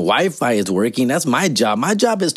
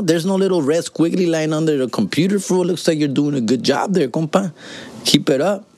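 ordinary talk

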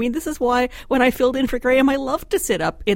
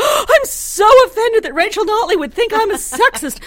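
high, loud hammed-up indignation; mock offended politician; 13 semitones higher, 8 dB louder than usual. Columnist mocking Greg Clark's reply, hammed-up offence. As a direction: squeal it like a wounded stage victim.

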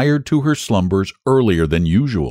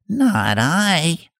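much higher squeaky rat voice; rat refusing; 8 semitones higher than usual. Acted. Rat refusing the hen, high quick voice. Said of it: "And the rat said".

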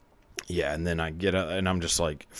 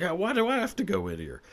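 whiny protest; 11 semitones higher, faster than usual. Retold or imitated. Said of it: "I was like".

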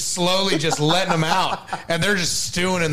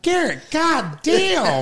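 scolding mother; 9 semitones higher, much slower than usual. Retold or imitated. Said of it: "Except it's like".

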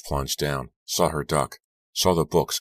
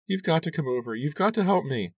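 much higher, tearful begging; sobbing plea; 12 semitones higher than usual. A narrator's reading. Crying friend begs Lizzie to come, much higher sobbing. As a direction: plead through sobs. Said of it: "Then she sobbed".

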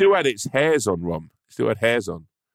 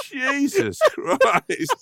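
slow, high, tearful laughing; real weeping laughter; crying with laughter; much slower than usual. Real, not acted. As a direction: gasp it out through tears of laughter. Laughing to tears.

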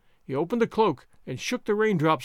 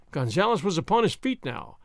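usual speaking voice

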